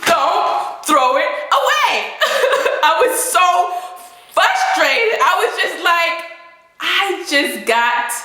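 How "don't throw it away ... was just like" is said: normal talking voice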